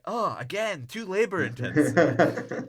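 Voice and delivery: high, loud hammed-up complaint; mock exasperated whine. Pauly turns down selling sperm, mock exasperated whine. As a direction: wail it as a put-upon slacker. Pauly rejects yet another job idea.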